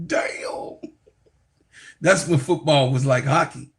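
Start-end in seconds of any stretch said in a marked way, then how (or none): 0.1-0.8 breathy whispered damn under the breath. Retold or imitated. Nephew hearing how uncle knocked Lynn Swann out, whispered awe. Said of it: "I was like".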